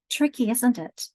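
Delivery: normal talking voice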